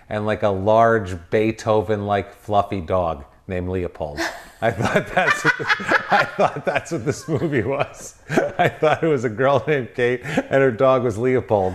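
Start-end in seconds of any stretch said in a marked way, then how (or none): 4.2-11.8 talking through real laughter, higher; laughing. Real, not acted. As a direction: laugh your way through the confession. Laughing at own mistaken idea.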